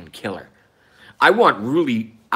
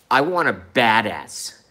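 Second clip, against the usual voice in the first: ordinary talk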